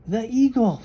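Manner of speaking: high, loud alarmed cry; frightened cry. Acted. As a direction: cry out at the shadow overhead. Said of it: "she cried".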